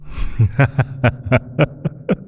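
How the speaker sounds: airy, rising whispered giggle; breathy demon giggle. Acted. Demon patron laughing at her new pet, airy rising giggle. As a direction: giggle delightedly at the helpless mortal.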